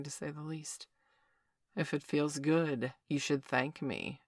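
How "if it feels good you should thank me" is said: slow commanding man. A narrator's reading. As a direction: draw it out slowly, in control. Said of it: "Victor said".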